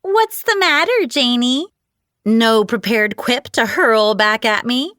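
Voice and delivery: high whiny taunting voice; whiny falsetto. A narrator's reading. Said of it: "Her whiny falsetto voice".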